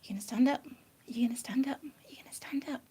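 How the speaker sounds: soft unvoiced whisper to the pet; hushed coaxing whisper to a pet rabbit. Real, not acted. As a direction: coax gently in a whisper.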